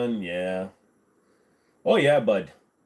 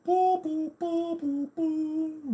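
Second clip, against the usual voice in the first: high boo chant; much slower than usual. Acted.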